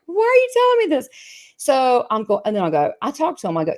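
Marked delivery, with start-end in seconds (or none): 0.1-1.1 much higher and fast, exasperated question; mock exasperation. Medium quoting her reaction to spirit. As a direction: toss it off in exasperation. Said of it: "I'm like".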